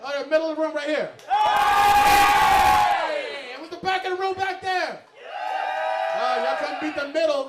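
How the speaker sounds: high, loud crowd-hyping call. Real, not acted. Host calling to the back of the room, hyped shout.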